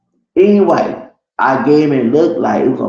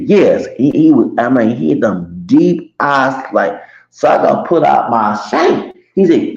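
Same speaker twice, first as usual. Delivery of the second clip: normal talking voice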